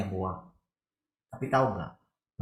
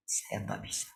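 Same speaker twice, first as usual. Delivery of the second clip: a softer, breathy admission; whisper; 10 dB quieter than usual. Real, not acted. Preacher admitting his own weakness, in a whisper. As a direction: whisper it like a confession.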